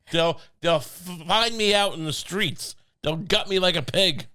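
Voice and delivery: ordinary talk, nothing marked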